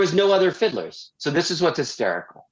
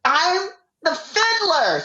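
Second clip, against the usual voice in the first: eager high pipe; 13 semitones higher, slower than usual. Retold or imitated. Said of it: "Renee goes".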